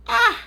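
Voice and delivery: sharp, very high, loud "Ah!" of outrage; a sudden scandalised yelp; loud high exclamation of disbelief; shocked cry. Real, not acted. Outrage at a child breaking things.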